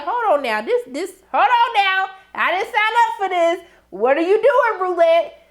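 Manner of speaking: high, loud mock alarm, building as it goes; alarmed protest. Viewer calling Roulette off the gun game, in mock alarm. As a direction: playfully cry out in disbelief, voice climbing.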